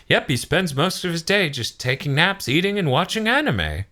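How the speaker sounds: high-pitched, lilting attendant voice; Whis. Retold or imitated. Explaining his master's naps to the fighters, lilting high voice. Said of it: "And then Whis is like".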